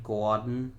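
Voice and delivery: Gordon the Boston way, "GAW-dn"; Boston accent. Real, not acted. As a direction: drop the r in Gordon, Boston-style. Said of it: "saying Gordon with a Boston accent".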